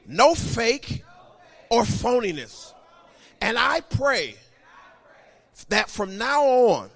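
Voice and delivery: measured call-and-repeat prayer in short phrases; leading a confession. Real, not acted. Pastor leads congregation repeating after him, proclaimed confession. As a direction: proclaim each phrase, then leave room for the echo.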